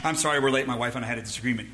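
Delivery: low, rushed late-arrival apology; mock offhand excuse. Husband excusing lateness to church friends, mock offhand.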